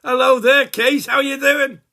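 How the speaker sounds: loud, high, laughing hello; booming greeting. Acted. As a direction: boom out the hello to Kate. Loud cheery greeting to camera.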